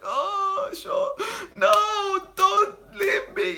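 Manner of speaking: high, slow, laughing through fake sobs; mock crying. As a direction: whimper it like a hammed-up crybaby while the hair hurts. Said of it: "I was crying".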